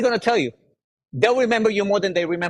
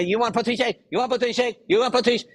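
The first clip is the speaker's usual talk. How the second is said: higher, nagging sales pitch; mock pushy seller; 8 semitones higher than usual. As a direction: imitate a seller pushing shakes on everyone.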